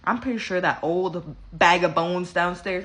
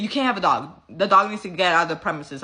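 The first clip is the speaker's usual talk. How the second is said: usual speaking voice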